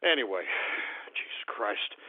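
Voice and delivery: very low, hushed mutter; spent. Real, not acted. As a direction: trail down, drained after ranting. Spent after the outburst.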